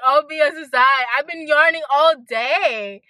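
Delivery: ordinary delivery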